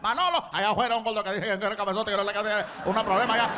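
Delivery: very fast Cuban Spanish rant; rapid trash talk. Retold or imitated. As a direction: rattle off complaints to the cook. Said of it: "starts talking trash to one of the cooks".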